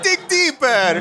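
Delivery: hammed-up sage in a Slavic accent; Obi-Wan wisdom. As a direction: deliver it like a Jedi master's advice. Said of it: "this Obi-Wan moment of".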